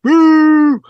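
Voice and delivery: high, loud crowd boo. Retold or imitated. The crowd booing Mark in his cow costume, a loud high boo. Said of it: "just went".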